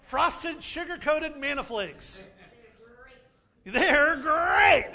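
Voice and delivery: loud booming cereal-mascot cheer; Tony the Tiger. Retold or imitated. Pastor clowning as a cereal mascot, loud and booming. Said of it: "Tony the Tiger".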